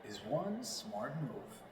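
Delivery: slow, smooth commercial voiceover; slow announcer tagline read; Kia ad read. Shown as a demonstration. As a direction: land the tagline like a car ad. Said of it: "I was just Kia Motors".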